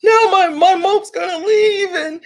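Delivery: high, panicky, near tears; freaking out. Retold or imitated. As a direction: panic about losing him. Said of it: "Sugar's freaking out".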